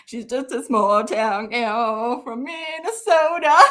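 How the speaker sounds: slow, drawn out through laughter; laughing tease. Real, not acted. Teasing Katie mid-fit, slow laughing delivery. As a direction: force it out slowly while cracking up.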